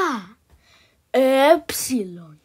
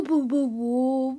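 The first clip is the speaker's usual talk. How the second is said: normal talking voice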